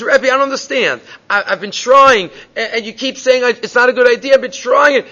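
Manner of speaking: high, pleading, close to tears; student in tears pleading with his teacher; tearful plea. Retold or imitated. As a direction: beg the rebbe as you break down. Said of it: "he's in tears".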